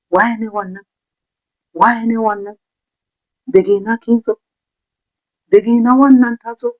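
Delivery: hot-tempered driver barking orders; angry driver. Retold or imitated. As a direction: tell off the kid like an irate driver. Angry driver yelling in Hausa.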